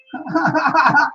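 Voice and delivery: high, rapid giggle; delighted laugh. Real, not acted. Delighted reaction to viewers' locations.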